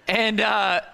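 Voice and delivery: ordinary talk, nothing marked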